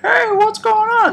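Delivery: put-on very high, squeaky cheerful old man; a sweet old-timer saying hello; friendly old guy. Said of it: "Friendly old guy's like".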